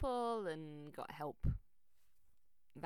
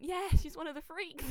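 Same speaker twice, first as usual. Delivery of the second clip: joking; 12 semitones higher, 12 dB louder than usual. Retold or imitated. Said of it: "And I went".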